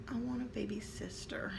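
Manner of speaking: soft confiding whisper. Retold or imitated. Son confessing to mother in his room, whispered. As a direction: whisper it like a secret.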